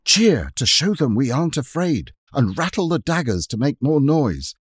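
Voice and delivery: loud, high urgent orders; a boy captain firing up his troops; rallying call. Acted. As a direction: rouse the others to cheer. Robert rallies the others.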